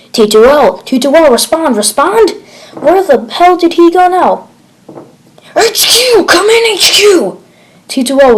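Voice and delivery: high, loud cries into the radio; desperate shouting. Acted. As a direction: yell for anyone to answer. Desperate radio calls unanswered.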